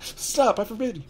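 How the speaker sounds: high shrieking panic; panicked yell. A narrator's reading. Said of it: "yelled Uncle Vernon in a panic".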